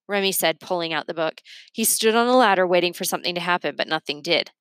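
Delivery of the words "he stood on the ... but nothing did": ordinary delivery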